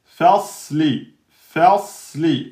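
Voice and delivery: "Fasli" with a heavy s; heavy sad. Shown as a demonstration. Teacher models for students. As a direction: model the emphatic s. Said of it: "sad, soukoun, emphatique".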